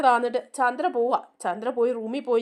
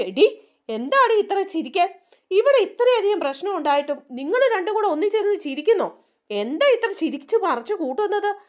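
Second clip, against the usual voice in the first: higher, sharp rebuking tone; angry scolding; 7 semitones higher than usual. Retold or imitated. Mother-in-law Chandra scolds laughing daughters-in-law, sharp high rebuke. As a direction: tell them off for laughing.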